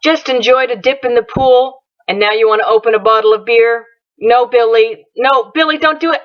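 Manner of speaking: usual speaking voice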